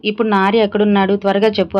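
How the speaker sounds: plain reading voice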